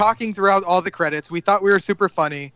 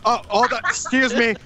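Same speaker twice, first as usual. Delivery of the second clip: higher-pitched stumbling apologies; acted tipsy apologising; 4 semitones higher than usual. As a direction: play a wobbly drunk excusing himself. Drunk, apologising while stumbling past.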